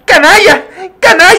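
very high, loud scolding cry; hammed-up angry shopkeeper; furious shout. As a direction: yell at the vandal.

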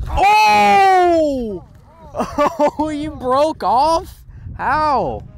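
high drawn-out cry of disbelief; shocked. Real, not acted. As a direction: wail it high, stunned by the loss. Friend lost his fish.

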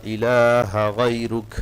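إله غيرك read slowly with the long lam; slow recitation. Shown as a demonstration. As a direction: stretch إله out. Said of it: "লামে তিন লিফ টান". (ধীরে ইলাহা গায়রুক)

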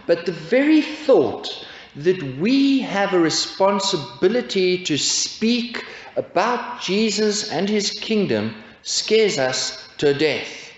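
ordinary delivery

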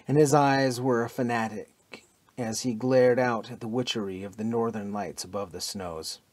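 plain reading voice

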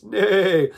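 high, loud neigh with vibrato; whinny loudly, voice quivering; quivering horse neigh. Shown as a demonstration. Practicing vibrato with animal sounds, horse neigh. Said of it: "That's like practicing vibrato with your voice".

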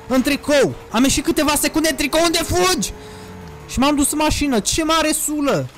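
high-pitched complaining; exasperated rant. Real, not acted. Streamer ranting about catching a cold in a T-shirt, exasperated.

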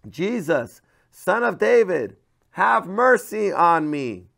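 loud, slow, high pleading cry; drawn-out pleading shout for mercy; desperate cry. Acted. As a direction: call out to Jesus like a desperate blind beggar. Blind man crying out to Jesus.

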